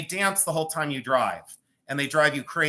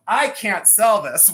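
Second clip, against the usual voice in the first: slow, high imitation of a complaining reseller; lightly voiced moaning reseller; mock whiny reseller; 4 semitones higher, 7 dB louder, slower than usual. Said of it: "the resellers that go".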